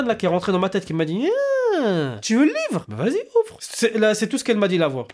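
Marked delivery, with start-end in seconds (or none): 1.8-2.8 higher, tempting imp; small high devilish whine; little devil voice. Acted. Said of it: "une petite voix de chétan". 2.9-3.6 very high, coaxing imp; small high-pitched tempter; little devil voice. Acted. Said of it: "une petite voix de chétan".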